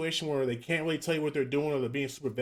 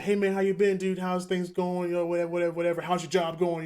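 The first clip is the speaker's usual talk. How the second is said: fake friendly; 5 semitones higher than usual. Mimicking a scammer's friendly opener.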